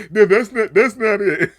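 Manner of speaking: loud, high laughing protest. Retold or imitated. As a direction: crack up while acting out an objection. Laughing while acting out the scene.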